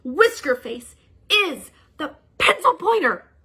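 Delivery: high, slow accusing professor voice; dramatic accusation. Acted. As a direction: unmask the villain with a trembling cry.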